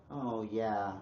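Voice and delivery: soft, very slow, crestfallen sigh of a line; hammed-up gloom, soft and dragged out; mock dejected. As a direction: play it as tragic over cereal. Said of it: "And then you're like".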